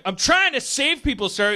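high, loud protest to the boss; pleading firefighter. Acted. Firefighter answers yelling boss.

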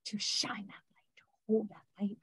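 hushed whispered blessing. Acted. Channeled beings whisper their blessing to the listeners, soft whisper. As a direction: breathe the words out without voice.

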